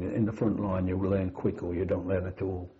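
normal talking voice

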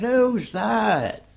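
high-pitched mild shout; retold call across. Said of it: "And I shouted across".

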